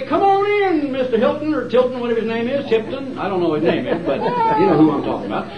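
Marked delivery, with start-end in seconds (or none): 0.0-1.5 very high, sing-song fake welcome; mock welcome. Sarcastic welcome to the TV preacher, very high. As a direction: gush a sarcastic hello. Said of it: "You wouldn't say".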